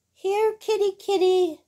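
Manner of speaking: high sing-song call; little girl calling her cat; calling the kitty. A narrator's reading.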